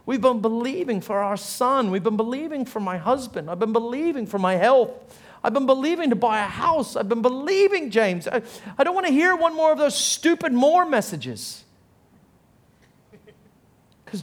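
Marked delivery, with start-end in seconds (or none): 0.0-11.6 high-pitched, exasperated venting; fed-up complaint. Acted. Weary believer complaining to pastor about waiting, acted exasperation. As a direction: pile up grievances, acting out someone who has had enough.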